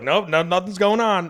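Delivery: high, loud, flustered denial. Retold or imitated. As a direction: brush it off in a loud panic. Denying being high.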